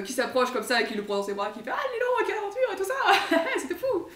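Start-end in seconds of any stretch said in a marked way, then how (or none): 1.7-4.1 much higher, laughing hearty welcome; jovial Khan. Retold or imitated. The Khan hugging Koulouf, high and laughing. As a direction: boom out the delight of a reunion.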